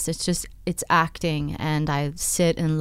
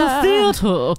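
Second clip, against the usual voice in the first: put-on mid-Atlantic accent, higher and louder, theatrical; 6 semitones higher, 4 dB louder than usual. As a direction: say "The theater." like a grand old Hollywood star in mid-Atlantic accent. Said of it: "That mid-Atlantic accent that doesn't exist".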